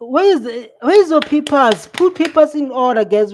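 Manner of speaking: high, loud telling-off; loud scolding. Real, not acted. Streamer telling the lawyers to fix their papers, loud and scolding. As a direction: bark at them to sort it out.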